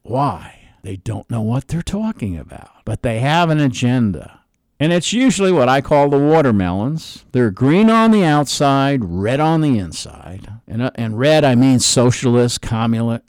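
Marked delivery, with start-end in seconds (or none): none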